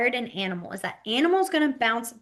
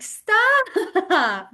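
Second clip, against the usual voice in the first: mock protest; 9 semitones higher, 5 dB louder than usual. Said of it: "And they're like".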